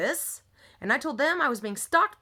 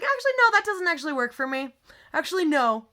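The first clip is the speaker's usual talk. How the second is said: high, loud objection; indignant refusal; 5 semitones higher, 6 dB louder than usual. Retold or imitated. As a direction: re-enact firmly shutting someone down. Acted refusal to replacement coach.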